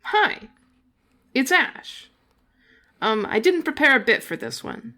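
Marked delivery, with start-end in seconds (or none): none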